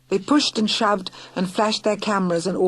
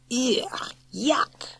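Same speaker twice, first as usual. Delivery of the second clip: put-on childish disgust; 6 semitones higher than usual. Moses disgusted by a kiss.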